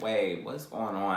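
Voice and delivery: low, very soft puzzlement; retold confusion. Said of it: "I'm confused".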